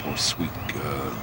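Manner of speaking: low, slow, softened pity; soft pity. Acted. Pitying the poor sweet girl, soft and slow.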